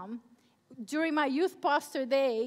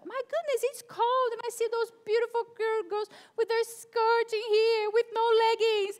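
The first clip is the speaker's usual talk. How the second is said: higher and louder, worked up; anxious mom outburst; 8 semitones higher, 9 dB louder than usual. Real, not acted. New mom worrying aloud to the youth about school, worked up. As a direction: fret out loud about the cold and her daughter. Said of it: "I'm so scared of being a mom now".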